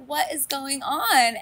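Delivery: high, slow baffled cry; exasperated disbelief. Retold or imitated. Viewer baffled by Jen's choice, high disbelief. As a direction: throw up your hands in disbelief. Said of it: "I was like".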